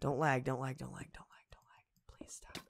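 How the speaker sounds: anxious whispered pleading to the game. Real, not acted. Gamer pleading with laggy game in a whisper. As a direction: breathe it, begging the lag away. Begging the game not to lag.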